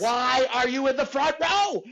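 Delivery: high, loud, slow exasperated yell; comic yelling at a front-row guest; retold yell.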